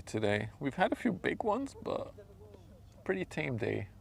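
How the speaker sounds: ordinary talk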